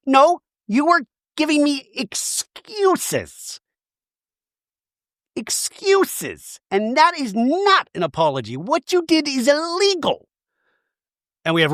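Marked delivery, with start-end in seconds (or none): 0.1-10.3 high, slow, indignant drawl; mock outraged Shannon. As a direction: spell out her outrage slowly. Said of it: "And Shannon goes".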